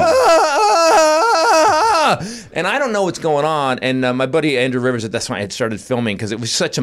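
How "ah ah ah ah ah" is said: high, loud mock panic screams; imitated panic wailing. Blind wife having a panic attack, imitated high wailing. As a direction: wail in repeated hammed-up bursts. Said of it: "She starts having a panic attack".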